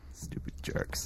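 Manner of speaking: under-the-breath grumble; whispered insult. Acted. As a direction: mutter it so they can't hear. Muttered insult while leaving.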